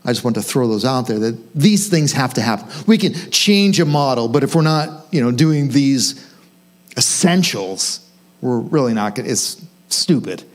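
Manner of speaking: ordinary talk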